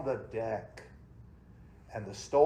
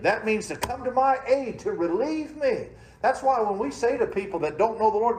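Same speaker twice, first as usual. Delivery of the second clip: raised, high preacher's call to the congregation; fervent preaching; 9 semitones higher, 6 dB louder than usual. Acted. Pastor preaching to his church, high and loud.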